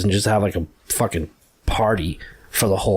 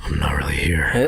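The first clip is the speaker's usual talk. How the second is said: whispered, lower, playing invisible; joking whisper; 7 semitones lower than usual. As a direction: whisper it like you're not there. Joking as invisible sound man.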